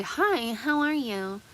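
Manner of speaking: high, bright fake service greeting; customer service voice. Mocking retail greetings to customers, high fake cheer. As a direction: chirp it like a forced-cheerful clerk. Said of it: "play clown".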